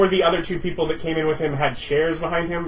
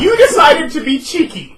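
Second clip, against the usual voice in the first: high, loud laughing retort; 14 semitones higher, 10 dB louder than usual. Real, not acted. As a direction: laugh it out teasing.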